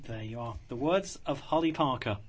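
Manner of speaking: normal talking voice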